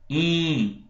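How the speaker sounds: nasal "im", held through the nose; nasal i vowel. Shown as a demonstration. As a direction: sustain a nasal "im". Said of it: "eu posso falar". ("im" nasal e sustentado)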